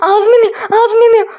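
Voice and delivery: burning mouth panic. Acted. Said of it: "Ne ağzın yanıyor?".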